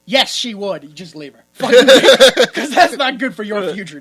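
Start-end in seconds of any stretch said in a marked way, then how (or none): 0.8-1.4 dropped low and quiet; hushed, low verdict; matter-of-fact advice. Real, not acted. Aside before loud repeat. 1.5-2.4 loud, punchy outburst; sudden loud insistence; emphatic advice. Real, not acted.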